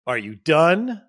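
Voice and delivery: loud, curt, a little sarcastic; snippy and impatient. Shown as a demonstration. Husband cutting off his upset wife, annoyed and loud. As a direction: bark it like you're fed up. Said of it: "But not in a".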